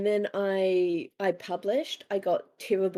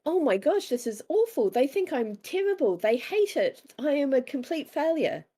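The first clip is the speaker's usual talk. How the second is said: mock panic; 7 semitones higher than usual. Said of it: "I'm like".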